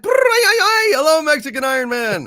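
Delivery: high sing-song hello; sing-song greeting. Acted. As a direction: swoop way up on hello.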